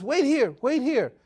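high, loud, hurried "wait here"; urgent plea. Retold or imitated. Wife begging the angel to wait, high and urgent. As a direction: hold him there before he vanishes.

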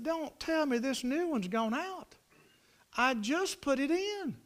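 high, slow, drawn-out dismay; exasperated disbelief. Retold or imitated. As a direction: stretch it out in high-pitched disbelief. Retold dismay at failed fence.

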